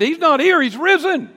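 very high, loud proclamation; triumphant angel. Acted. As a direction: proclaim the news in joy.

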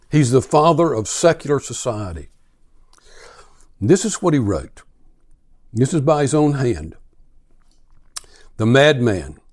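ordinary talk, nothing marked

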